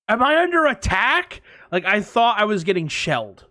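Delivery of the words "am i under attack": much higher, louder alarmed cry; panicked. Retold or imitated. Shaken by the implosion, high panicked question. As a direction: cry out fearing shelling. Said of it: "I literally was like".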